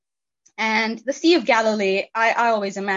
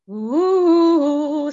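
long, loud, higher eerie "oooh"; spooky wail; 7 semitones higher, 7 dB louder than usual. Acted. Setting the graveyard scene like a scary movie, spooky wail. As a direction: imitate scary movie music with a drawn-out wail.

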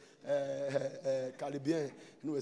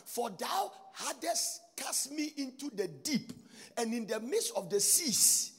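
slow, drawn-out declaiming; preached scripture reading; 6 semitones higher, slower than usual. Acted. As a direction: stretch out each phrase of the verse.

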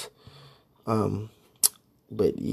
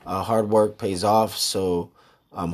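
usual speaking voice

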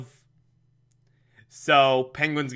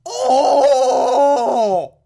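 yelped oh; 11 semitones higher, 7 dB louder than usual. Real, not acted. Reacting to own joke.

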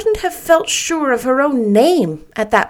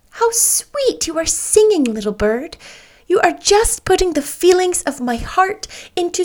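tender to a bird; 8 semitones higher than usual. Acted. Anne singing praise to bird.

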